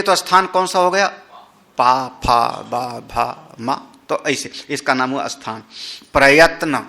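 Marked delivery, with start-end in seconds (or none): 1.8-3.7 पा, फा, बा, भा, मा with both lips closing; lip consonants demo. Shown as a demonstration. Teacher demonstrating lip sounds, sounding पा फा बा भा मा. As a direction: sound out पा फा बा भा मा one by one. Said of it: "दोनों होंठ मिलेंगे".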